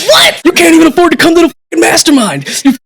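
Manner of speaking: high, loud, disbelieving outburst; incredulous mock shout. Firing back at Wes in disbelief, mock shouting. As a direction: yell back in laughing outrage. Outraged reply while retelling.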